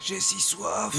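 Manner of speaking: high, soft plea of the thirsty; parched pleading. Acted. Thirsty captive begs for water, pleading. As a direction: beg for water, pitifully.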